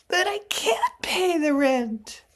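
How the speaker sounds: high, loud, pleading damsel; damsel in distress. Acted. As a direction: wail it like a helpless maiden. Melodrama heroine pleading.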